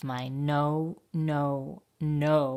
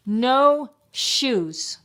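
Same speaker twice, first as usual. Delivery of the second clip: loud, high storybook yell; shouting; 8 semitones higher, 8 dB louder than usual. A narrator's reading. Child taking off shoes by herself, shouting. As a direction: shout each word as a refusal.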